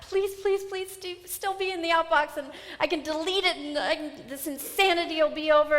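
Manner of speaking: high, pleading, panicky; panicked pleading. Retold or imitated. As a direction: beg the email to come back. Pleading with the outbox.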